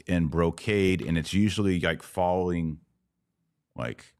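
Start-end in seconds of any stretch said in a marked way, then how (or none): none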